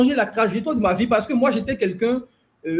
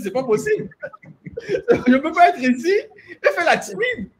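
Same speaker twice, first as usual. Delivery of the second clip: retelling her words through laughter; laughing quote; 8 semitones higher than usual. Retold or imitated. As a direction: quote her while laughing, a bit higher. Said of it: "tu as dit".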